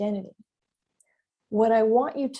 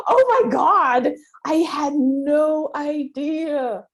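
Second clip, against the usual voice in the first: much higher, louder and slow, amazed; excited realization; 6 semitones higher, 8 dB louder, much slower than usual. Retold or imitated. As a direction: stretch it out like a sudden revelation. Retelling a sudden discovery.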